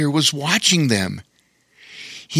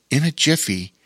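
ordinary delivery

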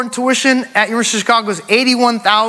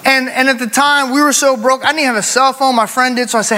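ordinary delivery

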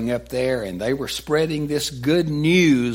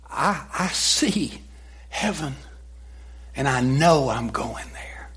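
hushed dying words; 3 dB quieter than usual. Retold or imitated. Preacher hushes Stephen's dying words to a whisper. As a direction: let it fade into a whisper.

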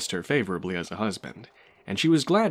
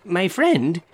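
higher, louder proud opening; 8 semitones higher, 6 dB louder than usual. A narrator's reading. Glassblower addressing the butler, proudly raised. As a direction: draw himself up and announce it. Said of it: "he began proudly".

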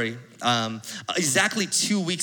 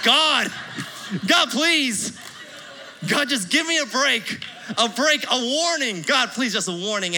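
desperate pleading; 7 semitones higher than usual. Retold or imitated. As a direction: beg God frantically for mercy. Pleading prayer to God.

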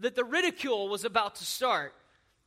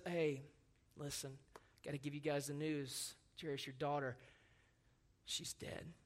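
much softer and lower, sinking into a whisper; hushed bad news; 9 semitones lower, 14 dB quieter than usual. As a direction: break the news gently, almost breathless. Messenger brings the bad news.

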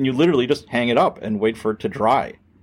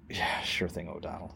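restrained reply; 6 semitones lower, 15 dB quieter than usual. Retold or imitated. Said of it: "he was gonna start screaming at me".

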